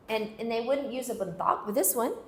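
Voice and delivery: ordinary talk, nothing marked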